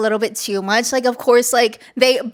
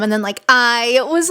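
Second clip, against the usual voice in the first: ordinary talk, nothing marked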